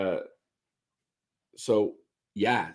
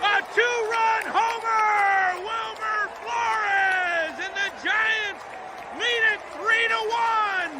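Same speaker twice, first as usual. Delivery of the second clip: shouting, much higher, drawn out; home run call; slower than usual. Shown as a demonstration. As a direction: roar it like the ball is gone. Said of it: "Here's my attempt at calling a game".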